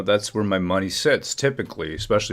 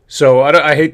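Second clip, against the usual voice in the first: sudden loud outburst; 7 semitones higher, 12 dB louder than usual. Real, not acted. Bursts out angry about frozen accounts.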